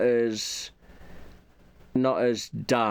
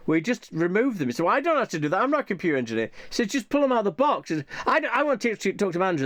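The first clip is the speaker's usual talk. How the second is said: higher, whiny protesting clerk; exasperated store worker; 7 semitones higher, faster than usual. Retold or imitated. Clerk refuses the cookie-filled computer, high exasperated whine. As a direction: push it up high, fed up with the customer.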